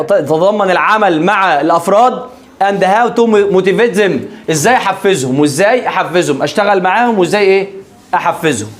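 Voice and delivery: normal talking voice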